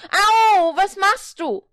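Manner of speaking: very high, loud furious yell; angry shout. Acted. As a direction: yell in pain and anger. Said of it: "schrie der Teufel zornig".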